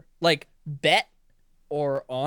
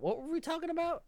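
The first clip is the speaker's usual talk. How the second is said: ordinary talk, nothing marked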